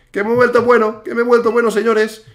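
high, loud and elated; thrilled gamer bragging to viewers; excited boasting. Real, not acted. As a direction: crow about your sudden success. Boasts after a run of successes.

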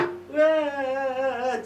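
slow, small crying sound; small whimper. Acted. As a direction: cry under the breath. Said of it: "ちっちゃい声で泣いても同じやがな". (小さな声でしくしく泣く)

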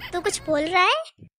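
ordinary talk

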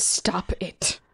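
whispered, pleading stop; frightened whisper. Acted. Friend begging the speaker to stop, whispered. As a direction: beg under your breath.

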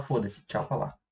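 low, soft, shrugging it off; a mock shrug of indifference; dismissive shrug. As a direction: brush it away under your breath. Shrugging off the insult.

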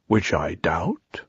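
low, slow and soft donkey mope; slow doleful murmur; gloomy. A narrator's reading. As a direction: sink down into resigned doubt. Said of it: "said Eeyore gloomily".

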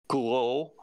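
"gros" as a French word, swallowed r; slow French-style "gros"; French pronunciation. Shown as a demonstration. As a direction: say Gouraud the French way. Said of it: "French pronunciation is like".

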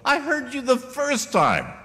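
high, slow, drawn-out annoyed reply; mock irritation, stretched out; exasperated retort. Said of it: "you go".

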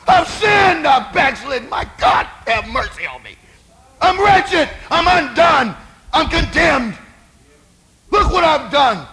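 high, anguished shouted confession; preacher acting out a broken man's wail; bitter weeping cry. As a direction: cry out to God in short, desperate bursts. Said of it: "he wept bitterly".

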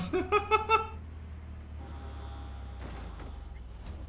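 long laugh after a near crash; relieved laughter. Real, not acted. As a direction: laugh off the scare.

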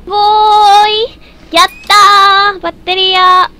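loud, high triumphant cry; triunfal. Real, not acted. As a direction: call it out loudly as you grab the prize. Celebra al coger la batería. (grito triunfal y agudo)

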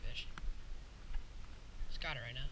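ordinary talk

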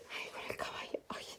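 soft whisper by the crib; whispering over a crib. Real, not acted.

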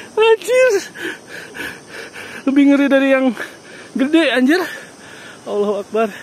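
high, tense frightened voice. Real, not acted. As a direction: plead in real fear. Scared while crossing the bridge.

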